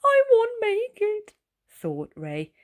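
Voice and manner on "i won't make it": high, despairing snail; sad. A narrator's reading. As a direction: give up hope up high. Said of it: "that made him so sad".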